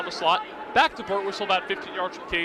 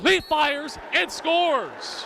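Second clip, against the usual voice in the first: higher, very slow drawn-out goal call; 6 semitones higher, much slower than usual. Real, not acted. Goal scored, play-by-play call.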